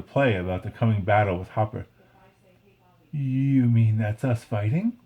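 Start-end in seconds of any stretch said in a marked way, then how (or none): none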